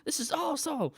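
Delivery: high, thrilled mock yell; excited scream. Halfway down the drop, screaming with imitated joy. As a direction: whoop it out like the ride is great. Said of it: "my screams turn into".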